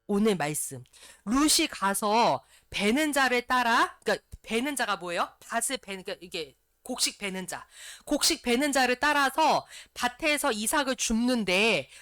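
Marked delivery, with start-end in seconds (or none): 1.3-11.9 loud, deliberate scripture reading. Acted. Pastor reading the day's verse to the church, proclaiming loudly.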